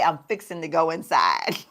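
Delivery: "I'm fixing to go inside" said easy and country; Southern phrasing. Shown as a demonstration. Showing how family back home says "fixing to", lightly Southern. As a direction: drop into home-folks Southern talk on "fixing to". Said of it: "we will say".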